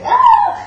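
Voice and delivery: short, high, loud shriek; mock scream. Running from the rain to save straightened hair, mock scream. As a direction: squeal as if fleeing acid rain. Mimics fleeing acid rain.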